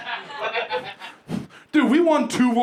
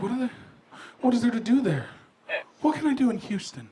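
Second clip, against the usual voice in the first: mildly worried imitation, softly voiced; retold scared woman; 5 semitones higher, 3 dB quieter than usual. Comedian imitates coworker scared to visit Houston, mildly anxious. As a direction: imitate a nervous first-time traveler. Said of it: "she was, like, scared to go to Houston".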